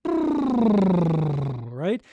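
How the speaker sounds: voiced lip trill sliding up. Shown as a demonstration. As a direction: buzz the lips with voice. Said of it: "Where you just relax the lips and you".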